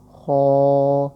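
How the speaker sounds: خو held long and loud; drawn-out letter name. Shown as a demonstration. Teacher spells for students, long loud خو. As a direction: stretch خو out as in spelling. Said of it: "آسان ہے، خو".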